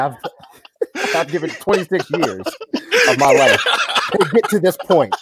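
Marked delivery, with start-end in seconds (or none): 1.1-5.1 high, laughing fake indignation; laughing mock outrage. Real, not acted. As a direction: rant while cracking up.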